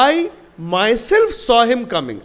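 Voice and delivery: very slow, myself pressed hard; "myself" stressed, each word spaced out; emphasis on myself. Shown as a demonstration. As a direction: lean on myself to show the emphasis. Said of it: "তা আমরা জোর দিচ্ছি".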